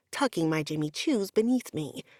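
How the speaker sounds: usual speaking voice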